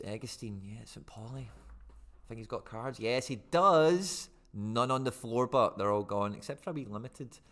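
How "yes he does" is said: slow, loud drawn-out triumph; excited. Real, not acted. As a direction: stretch it out with glee. Cards found, celebrates.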